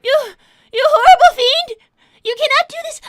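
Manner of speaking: loud, high melodramatic accusation of a fiend; hammed-up outrage. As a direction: play the scandalized heroine, loud and high. Outraged at a villain plan.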